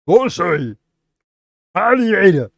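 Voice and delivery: loud, high, hammed-up Russian accent; over-the-top Russian accent shout. Shown as a demonstration. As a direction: belt it out in a mock Russian accent. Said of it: "read it in the Russian accent".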